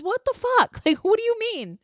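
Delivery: high-pitched what-the-hell; incredulous squeal. Real, not acted. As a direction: shriek your disbelief at the character. Her own baffled reaction to May.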